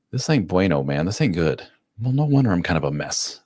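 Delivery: usual speaking voice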